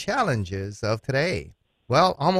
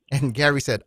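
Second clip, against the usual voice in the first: usual speaking voice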